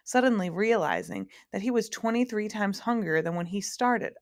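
usual speaking voice

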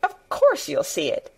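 high, indignant retort; indignant snap. A narrator's reading. Girl cutting off the boy's hysterics, indignantly. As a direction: snap it, pitch jumping on course. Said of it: "snapped Mary indignantly".

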